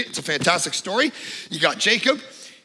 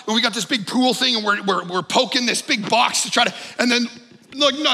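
usual speaking voice

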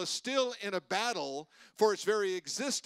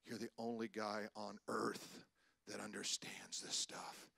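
quietly imitating Jim, soft and slow; hushed quote; 10 semitones lower, 13 dB quieter than usual. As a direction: say it low, like a confidence in an office. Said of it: "he goes".

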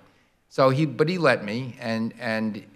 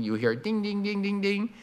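ding ding rising higher as the train nears; higher dinging bell; 8 semitones higher, 3 dB quieter than usual. Shown as a demonstration. Explaining Doppler effect, bell pitch rising. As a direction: imitate a crossing bell coming closer. Imitating approaching crossing bell.

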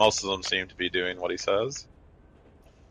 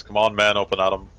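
louder wake-up call; rousing the troops; 7 dB louder, faster than usual. Acted. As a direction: rouse sleeping soldiers with energy. Sergeant shaking sleeping men awake.

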